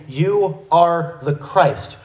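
"You are the Christ" slow, word by word; emphatic stress. Acted. Preacher restating Peter's confession, emphatic. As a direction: give "You are the Christ" deliberate weight on each word. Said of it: "he's being emphatic".

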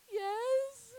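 very high, loud, drawn-out cry; overjoyed outburst. Retold or imitated. Accepting the friend's offer of insulin, overjoyed shout. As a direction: re-enact a desperate, delighted acceptance at full volume.

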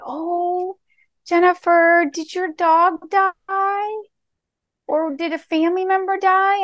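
high, drawn-out concerned question; worried sympathy. Retold or imitated. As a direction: imitate a client gently asking after bad news. Client fears a bereavement.

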